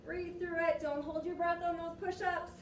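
ordinary talk, nothing marked

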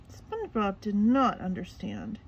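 ordinary delivery, nothing marked

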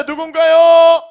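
slow, drawn-out, loud tease; booming prize announcer. Real, not acted. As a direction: stretch it out for suspense.

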